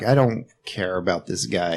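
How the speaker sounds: low, quiet and uninterested; mock indifference. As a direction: shrug it off softly. Quoting own indifference to villain.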